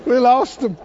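mild pretend lament for laughs; mock moaning. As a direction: moan it as a joke. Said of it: "you wouldn't moan and go".

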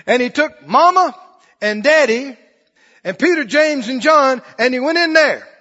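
high, loud preaching; preaching at full force. Acted. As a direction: drive the story home at full voice.

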